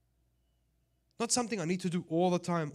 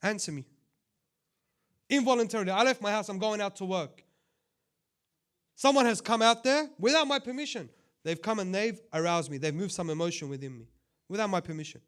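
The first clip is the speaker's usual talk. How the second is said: loud, high, cutting in hard; sharp raised tone; 4 semitones higher, 8 dB louder than usual. Real, not acted. Preacher scolding the sisters in a raised, sharp voice. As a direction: raise your voice to demand attention. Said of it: "forgive me for using this tone".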